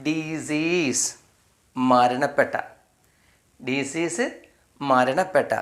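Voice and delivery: Disease drawn out syllable by syllable; slow sounding out. Shown as a demonstration. Teacher sounds out disease very slowly after spelling it. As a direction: stretch disease slowly for the class. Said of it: "D E C E A S E".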